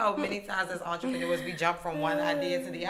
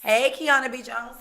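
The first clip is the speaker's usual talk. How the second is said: excited shout-out; 5 semitones higher, 6 dB louder than usual. Real, not acted. Host hypes cohost name.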